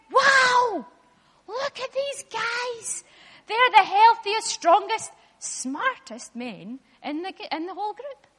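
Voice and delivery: amazed guard. Acted. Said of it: "and he said".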